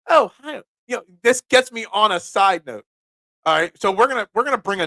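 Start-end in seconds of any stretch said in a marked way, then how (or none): 0.1-0.6 high, bright-toned shop greeting; sweet counter-clerk hello; customer service voice. Retold or imitated. Pizza shop owner greeting a customer in service voice. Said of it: "It's called my customer service voice".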